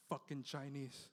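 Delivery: soft, low, contemptuous mutter; muttered insult. Retold or imitated. Comic as a taxi driver, a soft, low muttered insult. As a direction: spit it under your breath. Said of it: "he goes".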